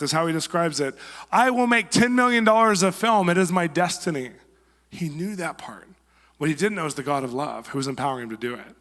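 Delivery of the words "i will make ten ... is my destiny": high, loud declaration to the sky; Jim Carrey's shout. Retold or imitated. Actor on Hollywood Hill shouting his vow, loud and high. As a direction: proclaim it like a vow shouted from a hilltop. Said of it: "screamed out to God and to the earth".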